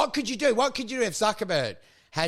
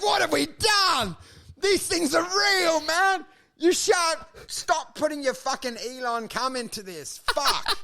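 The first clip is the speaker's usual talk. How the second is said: high shouting dismay; mock horror; 9 semitones higher than usual. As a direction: yell it like a horrified scientist. Horrified creator voiced.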